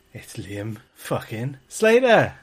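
slow hype announcement with punch on Slater; ring announcer intro. Acted. Host announcing special guest.

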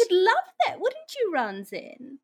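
high teasing sing-song. Acted. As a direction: tease him playfully. Player teases NPC with spider.

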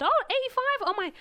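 high, loud Bristolian exclamation; Bristolian accent. Retold or imitated. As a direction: put on an amazed Bristolian pharmacist. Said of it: "well, she was Bristolian".

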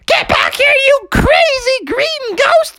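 high, furious cartoon mother; high-pitched battle-mad mom; Froggy's mom. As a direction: yell it in a squeaky falsetto, giving chase. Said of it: "Froggy's mom was like".

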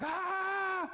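high-pitched "aah" yell; retold panicked yell. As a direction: act out freaking out with a high, held cry. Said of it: "I start yelling".

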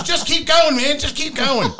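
loud, high mock-panicked plea; panicked urging. Scared friend urging escape down the steps, mock panic. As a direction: urge him on in a high panic.

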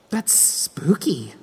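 hushed spooky whisper. Acted. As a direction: breathe it out in a whisper. Thinking spooky thought aloud.

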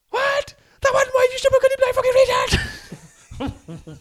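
high, loud, furious roar of abuse; furious yell. Retold or imitated. Player screaming abuse at the referee, furious high yell. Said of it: "he just goes".

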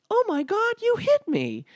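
very high, loud imitated wail; mock shriek. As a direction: shriek it like an outraged, wounded mom. Mom crying out after being hit.

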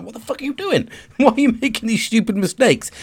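mock exasperated squeak at yourself; high-pitched self-scolding. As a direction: tell yourself off, pitched right up. Scolding self over mistakes.

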